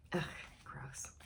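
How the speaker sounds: whispered gross; whispered disgust. Real, not acted. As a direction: breathe it out disgusted.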